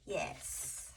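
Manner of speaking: hushed, private agreement; whispered yes. Real, not acted. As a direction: breathe a soft yes to yourself. Reader affirms card quietly.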